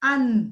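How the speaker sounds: अन as one held nasal vowel; nasalised, sustained अन; chandrabindu pronunciation. Shown as a demonstration. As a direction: demonstrate अन with the vowel sent through the nose. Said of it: "जिसका उच्चारण इस प्रकार होता है".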